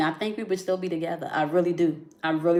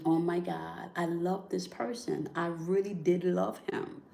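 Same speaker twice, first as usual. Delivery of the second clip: soft tearful inner voice; 9 dB quieter than usual. Retold or imitated. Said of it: "the tears that are coming out now".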